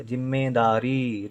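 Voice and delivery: "जिम्मेदारी" slowly, with the last ee held; long final ee. Shown as a demonstration. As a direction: draw out the री ending of "जिम्मेदारी". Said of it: "ई का उच्चारण लेकिन देर तक". ("जिम्मेदारी" के अंत में ई देर तक)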